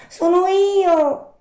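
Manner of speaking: high, loud puppet voice; Pinocchio calling up. Acted. Puppet asking for the Fairy at night, high calling voice. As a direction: call up loudly in a high puppet voice.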